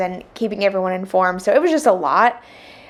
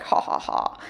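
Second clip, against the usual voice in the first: whispered, softer mock laugh; 5 dB quieter than usual. Wife gloating at husband cleaning the sink, whispered mock laugh. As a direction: breathe out a gloating ha ha ha. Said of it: "I was laughing inside".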